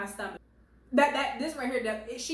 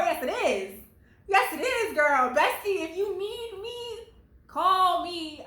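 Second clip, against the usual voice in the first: excited hype; 7 semitones higher, 4 dB louder, slower than usual. Real, not acted. Bestie hype to viewer.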